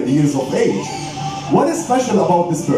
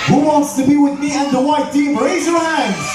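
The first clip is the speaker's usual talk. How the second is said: high rallying crowd call; animal show trainer; 6 semitones higher than usual. Acted. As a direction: hype up the audience.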